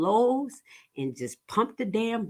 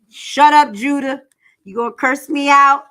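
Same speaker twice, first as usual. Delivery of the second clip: loud and higher, playfully telling him off; shouting; 5 semitones higher, 10 dB louder than usual. Real, not acted. Snapping at Judah across the room, loud and playful. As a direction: shut him down with a yell. Yelling at a co-host.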